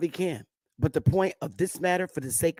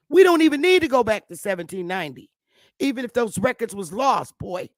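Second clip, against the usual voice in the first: loud, raised-pitch taunting challenge; heated challenge; 5 semitones higher, 5 dB louder than usual. Real, not acted. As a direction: push it out loudly at your opponent.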